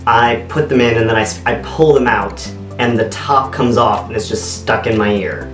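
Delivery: ordinary talk, nothing marked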